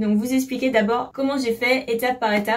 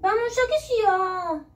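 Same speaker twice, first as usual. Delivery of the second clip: high-pitched pet talk; talking to a cat; 10 semitones higher than usual. Real, not acted. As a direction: ask your cat in a high little voice. Talking to her cat, off task.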